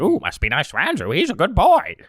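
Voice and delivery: high squeaky little guardian; Lorax voice. Retold or imitated. Mock Lorax defending Andrew in a deal. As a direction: play a fussy little protector. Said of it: "he'd kind of bound out and say".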